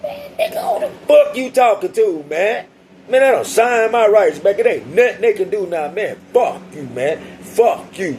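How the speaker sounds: talking trash. Retold or imitated. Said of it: "I'm talking shit".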